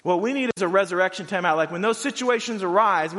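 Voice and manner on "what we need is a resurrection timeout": normal talking voice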